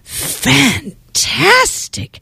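slow, drawn-out fantastic; gushing princess. Acted. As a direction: stretch it out in awe. Princess praises dragon.

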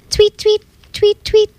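high chirpy tweets; birdsong. A narrator's reading. Reading birds singing to children, high chirps. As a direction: chirp it like a little bird. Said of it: "happy birds singing".

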